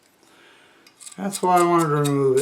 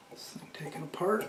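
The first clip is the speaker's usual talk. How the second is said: whispered aside; 2 semitones higher, 11 dB quieter than usual. Real, not acted. Muttering to himself while working.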